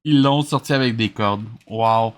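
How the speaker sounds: usual speaking voice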